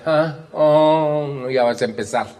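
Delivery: mock exasperation with a long oh; exasperated tone. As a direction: groan it as if fed up. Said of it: "¿Gustó ese tono?".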